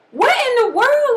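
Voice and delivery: high, slow, drawn-out astonishment; incredulous high-pitched exclamation; stunned disbelief. Acted. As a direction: act out utter shock, stretching the words. Acts out shock at lifeless baby.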